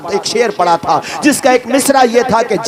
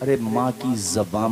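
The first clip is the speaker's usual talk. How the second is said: ordinary talk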